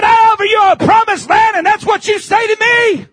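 loud, high rebuke; God's indignant rebuke. Retold or imitated. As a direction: throw it back at them. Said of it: "God said".

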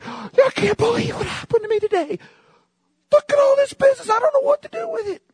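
high, loud, breathless amazed husband; overwhelmed husband. Retold or imitated. Husband telling his wife about his day, high and amazed. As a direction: squeal it high like a swamped husband. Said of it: "they'll say".

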